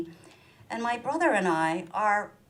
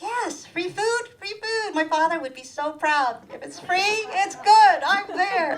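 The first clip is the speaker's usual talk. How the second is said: greedy joy; 11 semitones higher, 5 dB louder than usual. Retold or imitated. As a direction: squeal over free food. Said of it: "I'm like".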